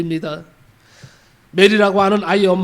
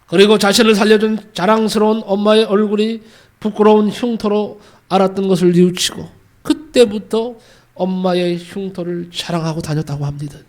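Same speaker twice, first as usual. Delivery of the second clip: normal talking voice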